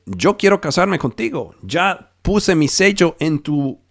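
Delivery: usual speaking voice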